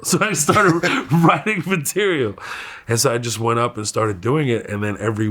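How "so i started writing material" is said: high, laughing through the words; laughing while talking. Real, not acted. Comic recalling his panic over material, laughing through it. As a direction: crack up as you tell it.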